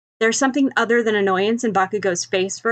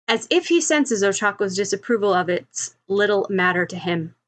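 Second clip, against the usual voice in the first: ordinary delivery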